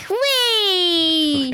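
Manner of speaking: high, long gleeful squeal; shouted "whee". Retold or imitated. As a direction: re-enact the fun with a drawn-out "whee". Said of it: "don't shout on the microphone".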